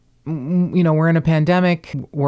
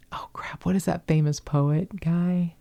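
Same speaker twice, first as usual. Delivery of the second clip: whispered to herself; 3 semitones higher, 6 dB quieter than usual. Real, not acted. Searching memory, muttering to self.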